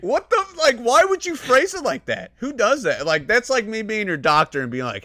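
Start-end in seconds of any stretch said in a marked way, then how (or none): 0.0-3.1 high, louder disbelieving cry; incredulous outburst. Retold or imitated. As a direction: react in shocked disbelief. Retold outraged reaction to the phrasing.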